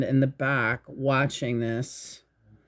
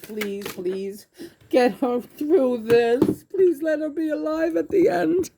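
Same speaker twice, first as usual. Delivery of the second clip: real crying plea; 10 semitones higher than usual. Real, not acted. Teller begging God for her to live, crying. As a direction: voice climbing high into tearful begging. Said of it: "You always make me cry".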